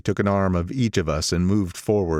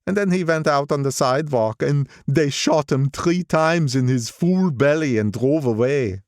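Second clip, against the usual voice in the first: put-on old gangster voice; 7 semitones higher than usual. Old gangster recalling a friend's murder, higher gloomy voice. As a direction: tell it like a grim memory, pitched up. Wolfsheim continues story.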